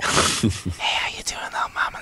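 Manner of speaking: playful flirty whisper; hushed mock-flirt whisper. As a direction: whisper it teasingly into the mic. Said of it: "Let me whisper".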